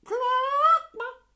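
very high, loud clucking; high hammed-up hen cluck. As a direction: squawk it like a startled hen. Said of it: "And the hen said".

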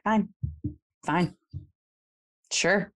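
passive-aggressive, grudging agreement; sulky. Acted. A two feeling unappreciated, sulking passive-aggressively. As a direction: give in with a little sulk, pausing between words. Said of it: "kind of sulk a little and be like".

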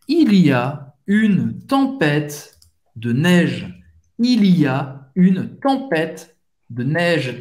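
slow French, each word set apart; unhurried, word-by-word teaching delivery; slow model pronunciation. Shown as a demonstration. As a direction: model the phrase slowly for beginners. Teacher models phrase for learners.

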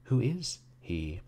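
slow, drawn-out languid question. A narrator's reading. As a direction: ask it languidly, stretching the words. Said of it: "asked Miss Fuller languidly".

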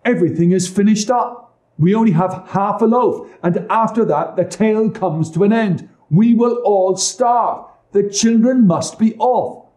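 high, loud desperate stepmother; harsh stepmother. A narrator's reading. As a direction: insist they must go. Stepmother urges abandoning children.

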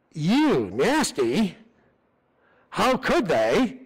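slow, sneering disgust at the sinner; a judgmental churchgoer, slow and sneering; mock contempt. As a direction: drag it out like a scornful gossip. Condemning judgmental church voice acted.

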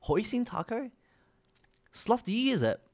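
accidental English accent on a questioning lilt. Real, not acted. Streamer asking chat about tacos, English accent creeping in. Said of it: "I don't know why I have an English accent".

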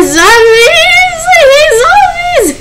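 high, loud shriek; shrieking at zombies. Real, not acted. Player shrieking at the zombies to go away. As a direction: scream them away.

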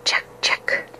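whispered, hissing name; ghostly hiss. Acted. Ghost hisses at the hunter from the swamp, whispering. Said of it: "the voice hissed".

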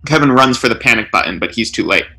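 ordinary delivery

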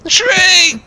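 very high, sharp warning; alarmed shout. Real, not acted. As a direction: yell the warning. Crashing into tree.

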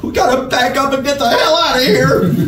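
high, tight-throated outcry; panicked, squeezed high voice; strained cry. A narrator's reading. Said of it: "cried out through a strained throat".

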